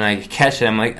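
ordinary talk

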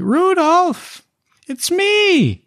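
very high, squeaky little halfling voice; halfling Amelia. Acted. GM voicing the halfling urging the party inside, high and squeaky. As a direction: squeak it out in a tiny urgent voice. Amelia greets Van Richten.

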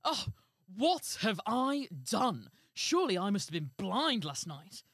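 loud, theatrical royal lament; hammed-up storybook remorse; remorseful king. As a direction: wail it slowly as a regretful king.

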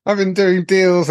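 high, laughing, slow brag; giggling mock gangster brag; laughing mock boast. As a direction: lets a laugh break through the boast. Laughing mock boast as drug lord.

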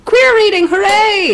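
high, loud mock cheer. Real, not acted. Co-host celebrating the queer reading, high cheer.

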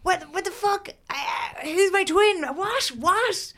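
high, baffled what the fuck; bewildered, flustered questions; mock stunned spluttering; shocked confusion. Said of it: "And Bobby's like".

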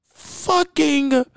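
high, very slow, crying drawn-out whine; Mimi voice. Retold or imitated. Mocking viewers who waste their lives, in a high slow crying whine. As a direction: stretch it into a high mock-weepy wail. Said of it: "In my Mimi voice from Love and Hip Hop Atlanta".

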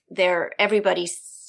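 ordinary talk

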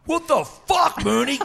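high shouted what the fuck; angry yell. Retold or imitated. Comic telling how Capper yelled after the slap, high shocked shout. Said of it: "He goes".

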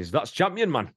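high, bright mock Geordie accent on the catchphrase. Host imitating the Geordie character, light mock Geordie accent. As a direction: toss it off like a Geordie builder. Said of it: "so he's a Geordie".

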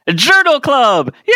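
high, loud, drawn-out cheer; excited announcement. Acted. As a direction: cheer it out like a game show. Announcing the segment.

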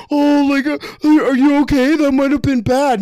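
high, fussing mock concern. Teammates fussing over the fallen player, high mock concern. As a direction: ham up the worried teammates.

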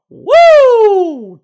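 loud, very high whoop; big "woo" of amazement; shocked whoop. Real, not acted. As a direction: whoop long and high at a savage line. Reacts to Barkley's shocking quote.

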